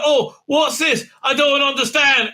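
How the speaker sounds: high, loud, slow mock bewilderment; high, loud mock confusion, slowed down; mocking a clueless Doctor. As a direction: shout it like a clueless caricature of the Doctor. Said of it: "the doctor is no, like".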